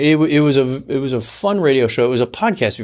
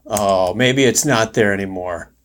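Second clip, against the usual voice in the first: normal talking voice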